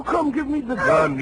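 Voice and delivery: high, urgent order; urgent command. Acted. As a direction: snap it out to get the gun. Doctor urges armed woman.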